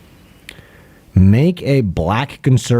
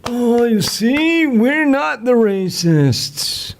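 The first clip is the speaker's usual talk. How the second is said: high, very slow mocking voice; simpering high mock voice; mocking conservative; 10 semitones higher, much slower than usual. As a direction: parody a smug conservative, stretched out. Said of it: "a cock conservative going like".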